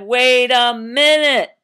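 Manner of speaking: loud, slow, stretched call; commanding halt. Acted. Baker silencing the crying town, loud command. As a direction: stop everyone dead. Baker halts everyone, all froze.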